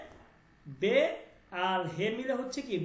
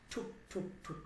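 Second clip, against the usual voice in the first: whispered knocking; 3 semitones lower, 11 dB quieter than usual. Shown as a demonstration. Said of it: "একটা আওয়াজ".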